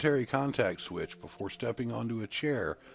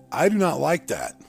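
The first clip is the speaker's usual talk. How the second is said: firm, loud, raised-pitch objection; stern; 6 semitones higher, 10 dB louder than usual. A narrator's reading. Narrator voicing a freed captive objecting to his friend, sternly. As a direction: put your foot down, loud and higher. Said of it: "I said sternly".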